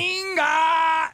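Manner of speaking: a sharp, high, loud one-word yell to the kitchen; imitated kitchen yell. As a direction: bark a single word at the cooks. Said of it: "they scream into the kitchen".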